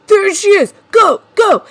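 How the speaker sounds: loud, harsh shout of a pursuer; scary abrasive yell. Retold or imitated. Pursuer yelling after the fleeing woman, loud harsh shout. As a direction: bark it like a hunter spotting prey. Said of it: "all scary and abrasive".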